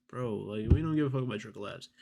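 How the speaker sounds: ordinary talk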